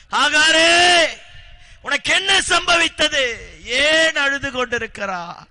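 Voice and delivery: loud, high divine call across the wilderness; God calling out. Acted. God calls weeping Hagar in the wilderness, loud high call. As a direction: call her name like God from above.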